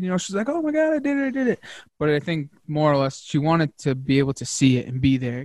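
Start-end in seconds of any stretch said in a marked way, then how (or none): 0.5-1.6 imitating excited wife. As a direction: imitate her thrilled, high squeal. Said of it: "she got a little bit excited".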